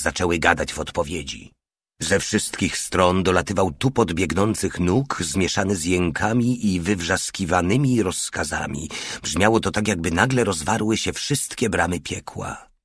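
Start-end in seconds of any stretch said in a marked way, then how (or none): none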